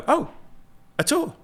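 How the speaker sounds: high-pitched mock wonder; sarcastic delight. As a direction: feign amazement at something very ordinary. Mocking the guide's sudden tour.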